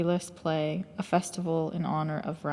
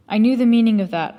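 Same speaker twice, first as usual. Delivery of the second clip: higher, louder burst of glad recognition; glad and relieved; 4 semitones higher, 8 dB louder, faster than usual. A narrator's reading. Narrator realises what his sentence meant, glad and relieved. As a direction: say it quickly with gladness and relief. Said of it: "with gladness and relief".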